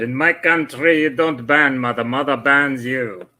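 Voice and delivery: mock Russian villain accent. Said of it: "Man, my Russian accent's really good".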